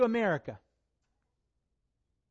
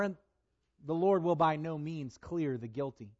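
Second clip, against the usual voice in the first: solemn reading that swells to thunder; scripture reading; 4 semitones lower than usual. Acted. Preacher reading Nahum's wrath to his church, building. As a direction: read God's wrath, building to a roar.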